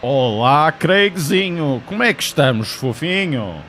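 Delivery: bright sing-song teasing of Craig; playful greeting. Acted. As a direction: call out to a friend with mock excitement.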